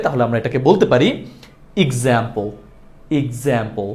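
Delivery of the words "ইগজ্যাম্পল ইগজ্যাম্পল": "এক্সাম্পল" as ig-zaem-pol, starting with i, falling; correct pronunciation. Shown as a demonstration. Teacher models example correctly for learners, correct pronunciation. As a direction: say "এক্সাম্পল" low and soft, opening on "ig". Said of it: "সঠিক করে উচ্চারণ করতে চাই".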